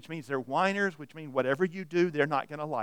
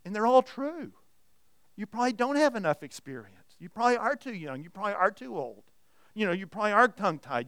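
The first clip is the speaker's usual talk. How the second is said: normal talking voice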